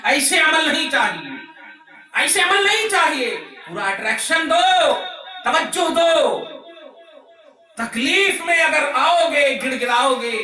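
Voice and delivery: high, impassioned sermon delivery; preaching at full force. Real, not acted. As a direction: drive it home to the congregation. (जोश भरी ऊँची तक़रीर)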